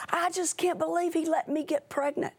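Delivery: a teenage girl near tears, imitated; upset teen girl. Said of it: "She goes".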